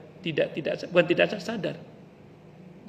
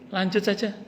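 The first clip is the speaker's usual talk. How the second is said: eager to move on; 5 semitones higher than usual. Acted. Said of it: "kata dia".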